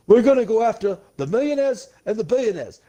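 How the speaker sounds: high, shouty Bernie Sanders rally voice; Bernie impression. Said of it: "he was like".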